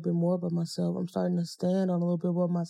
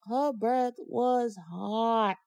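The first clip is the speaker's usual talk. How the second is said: slow, stretched-out complaint; drawn-out emphasis; 4 dB quieter, much slower than usual. Real, not acted. Telling listeners about a bad date, drawn-out emphasis. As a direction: drag each word out for emphasis. Stressing how bad breath was.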